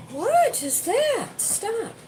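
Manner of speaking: high, slow squeaky disbelief; high mocking what is that; mock incredulous customer. Mocking the pizza order.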